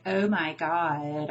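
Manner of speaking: slow, drawling valley girl impression; mock Valley girl accent, drawn out. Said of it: "like a valley girl and she's like".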